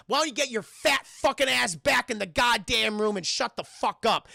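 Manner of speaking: loud, high cursing tirade; mock angry rant. Logan telling off the motel owner, loud. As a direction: tell her off hard. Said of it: "turns to this woman and says".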